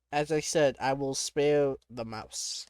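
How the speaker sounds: ordinary talk, nothing marked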